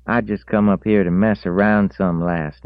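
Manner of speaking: put-on high, loud young country voice; country boy voice. A narrator's reading. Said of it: "he said".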